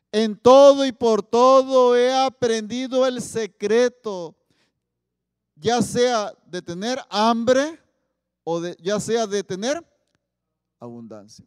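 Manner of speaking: loud, very slow, stretched proclamation; solemn scripture reading. Acted. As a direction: declaim it to the whole church. San Pablo quoted from the Bible. (proclamación lenta y fuerte del versículo)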